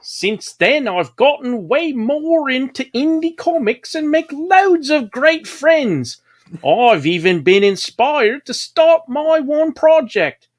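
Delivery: high, put-on posh-meets-Bristol voice far above his own; Mary Poppins Bristolian accent. Host reads a viewer comment in Mary Poppins Bristolian accent. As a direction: read the comment as a sing-song Mary Poppins with a Bristol twang. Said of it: "I'll do it in my Mary Poppins Bristolian accent".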